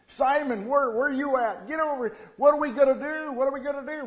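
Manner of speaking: high, loud frantic calling; a frightened shepherd yelling for his friend; panicked shout. Acted. Frightened shepherd calling Simon on the hillside, loud panic.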